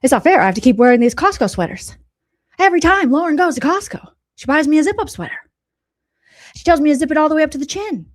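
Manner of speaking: hammed-up imitation of the host complaining; a loud, whiny take-off of Doctor John; mock Doctor John. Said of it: "Doctor John's like".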